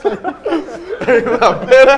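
loud, slow, laughing stretch; laughing drawl. Real, not acted. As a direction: drag it out while laughing. Laughing at the story.

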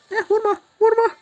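real panic, voice shooting up high; high panicked plea. Real, not acted. Pleading as enemy attacks. (oyunda can giderken çok tiz ve yüksek sesle yalvarma)

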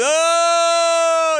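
loud, high mourner's wail; a long, loud, high keening "Oh"; imitated wailing. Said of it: "and he's screaming".

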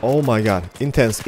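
ordinary delivery

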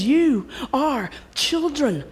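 loud, high rousing proclamation; fervent preaching. Real, not acted. Preacher rallying the congregation to claim blessings. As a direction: preach it like a revival. Preacher builds to full force.

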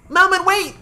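very high, loud cartoon cry; hammed-up high-pitched plea; mock desperate call. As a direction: shout it high as a sudden punchline. Reads imagined note as character cry.

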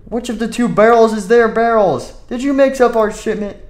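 loud, high accusation; angry boss. Acted. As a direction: demand answers from the worker. Boss discovers barrel mixup.

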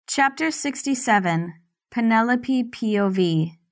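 plain reading voice

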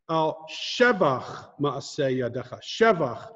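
"shevach" stressed on its first syllable. Shown as a demonstration. Teacher showing an unusual word, "shevach" with first-syllable stress. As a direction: punch the "she" in "shevach". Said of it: "you emphasize the first syllable".

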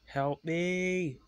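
high, stretched wail; cry for help. A narrator's reading. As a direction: plead in a high, long cry. Runaway engine cries for help.

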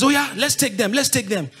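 rally the gang onto a new target; mock eager urging. Robber spotting another car to rob, mock eager urging.

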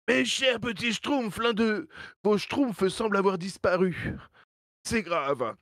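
put-on high strained old chief voice; Papa Smurf voice. (voix du grand Schtroumpf, aiguë et forcée)

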